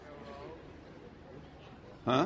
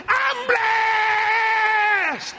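high, loud joyful shouts of blessed; shouting as loud as possible; 11 semitones higher than usual. Retold or imitated. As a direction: shout it with all you've got, pitched way up. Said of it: "I shouted as loud as I could".

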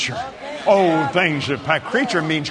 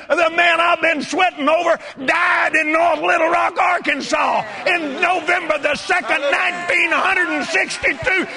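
very high, loud whooping celebration; jubilant shouting; 4 dB louder than usual. Retold or imitated. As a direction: shout it like a man set free. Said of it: "And then I got happy".

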